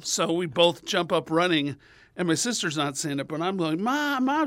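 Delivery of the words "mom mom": high, imitated kid calling out for mom; urgent child calling. Kid racing sister to mom, urgent high calling. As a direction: call out high like a tattling kid. Child running to tell on himself.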